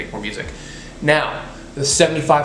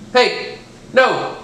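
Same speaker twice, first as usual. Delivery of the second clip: sharp scold; 9 semitones higher, 3 dB louder than usual. Real, not acted. Scolding puppy.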